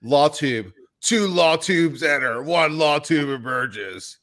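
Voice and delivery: slow, hammed-up death-match announcer; mock fight announcer. Host hyping a face-off with Rob, mock announcer. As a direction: hype it slowly like a mock fight promoter.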